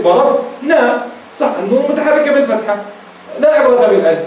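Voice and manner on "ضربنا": "ضربنا" drawn out slowly with a falling end; slow demo word. Shown as a demonstration. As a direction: stretch out "ضربنا" for the class. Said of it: "الحرف الأول منها متحرك". (نطق "ضربنا" ببطء)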